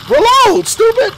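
high, loud self-scolding bark; frustrated yell. Real, not acted. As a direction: snap at yourself in frustration. Shouting at self mid-fight.

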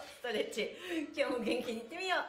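usual speaking voice

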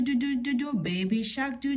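ordinary delivery, nothing marked

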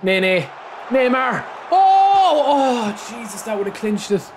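high yells falling into groaning disbelief; excited shout to dismay. Real, not acted. Gamer watching a shot just miss, shout then dismay.

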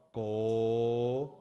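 very slow naming of the letter; slow recitation. Shown as a demonstration. Teacher naming the letters, slow. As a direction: say the letter name slowly. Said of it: "呃".

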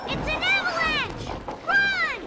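alarmed shout. Acted. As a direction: yell to get everyone running. Avalanche coming.

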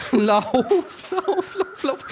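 frantic high-pitched urging; being chased. Real, not acted. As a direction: panicky squeal. Fleeing to the house.

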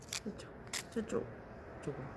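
breathy whisper pointing the way; hushed urging toward the path; whispered coaxing. Real, not acted. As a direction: whisper so the bug goes that way. Coaxing beetle quietly.

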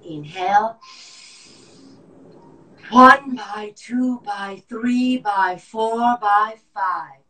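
slow loud forced count; lung function test. Shown as a demonstration. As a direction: push the breath out counting. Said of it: "breath count drill".

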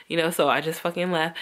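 normal talking voice

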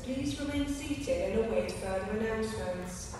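ordinary talk

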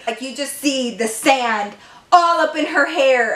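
ordinary delivery

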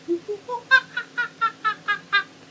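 high squealing laugh; laughing at being made a monkey. Real, not acted. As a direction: burst into a high giggle.

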